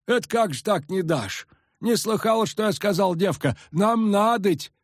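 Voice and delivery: high, folksy village peasant; rustic old villager, eager. A narrator's reading. Peasant indignant.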